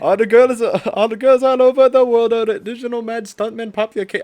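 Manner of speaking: put-on high, loud Indian-accented chant. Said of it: "Move It in Indian accent".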